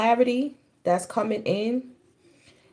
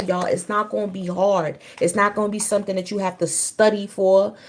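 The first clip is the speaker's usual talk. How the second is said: normal talking voice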